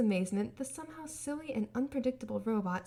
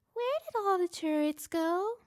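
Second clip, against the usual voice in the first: slow, much higher, louder question; high robot voice; 9 semitones higher, 4 dB louder than usual. A narrator's reading. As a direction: wonder aloud like a small child. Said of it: "Gur asked".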